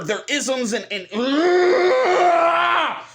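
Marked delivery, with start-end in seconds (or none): 1.1-3.0 long high scream of exasperation; frustrated roar. Real, not acted. Fed up with being labelled, frustrated scream. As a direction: let words collapse into a drawn-out yell.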